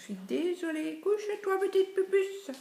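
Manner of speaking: pet talk. Real, not acted. Talking to her pet.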